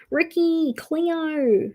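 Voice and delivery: exaggerated broad Australian accent on Cleo; broad Aussie. Shown as a demonstration. Mocking her own Australian name, broad Aussie. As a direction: drawl the names like a broad Australian. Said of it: "allowed to say it like that because I'm Australian".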